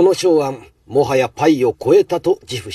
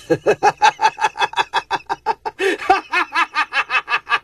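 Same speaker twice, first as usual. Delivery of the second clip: high, drawn-out mocking cackle; gloating laugh; 13 semitones higher than usual. Chef mocking the boy's claim, high gloating cackle. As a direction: laugh him to scorn.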